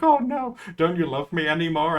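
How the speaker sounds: high, loud fake dismay; mock dismay. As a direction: overplay the heartbreak. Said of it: "she was like".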